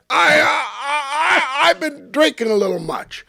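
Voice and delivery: put-on high, slow, half-crying drunk mumble; drunk man's excuse. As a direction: play the embarrassed drunk pleading. Drunk man voiced, teary.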